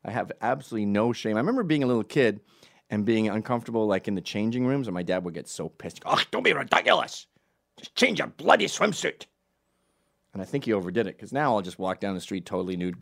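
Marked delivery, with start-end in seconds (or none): 6.1-9.3 louder, higher scolding dad; dad impatient with his shy son; exasperated dad. Retold or imitated. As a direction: snap like a fed-up dad. Dad annoyed in changing room.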